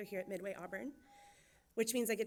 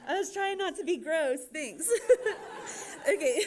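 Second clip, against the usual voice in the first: laughing; 9 semitones higher, 10 dB louder, slower than usual. Real, not acted. Laughing after the throat-clearing moment.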